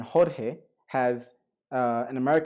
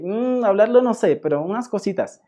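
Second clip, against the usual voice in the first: higher, louder Colombian impression; imitated Colombian speaker; 6 semitones higher, 5 dB louder than usual. Teacher recalling those Colombians, imitated higher voice. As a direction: mimic someone modestly admitting a little Spanish. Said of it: "like those Colombians who, who'd say".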